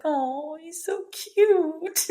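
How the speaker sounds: high, very drawn-out aww; gushing coo. Retold or imitated. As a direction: melt into a slow, high-pitched squeal of fondness. Retelling own gushing reaction.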